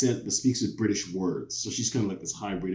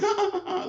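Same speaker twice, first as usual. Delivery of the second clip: high mocking ha ha laugh; mocking kid laughter. Kids laughing at his accent, high mocking laugh. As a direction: laugh at him like a teasing child. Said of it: "They're like".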